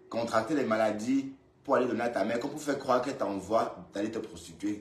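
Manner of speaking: ordinary delivery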